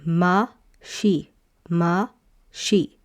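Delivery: ma, she sounded out in turn; blending syllables. Shown as a demonstration. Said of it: "So you have".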